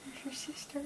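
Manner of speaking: whispering. Real, not acted. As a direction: breathe it gently. Whispering to baby.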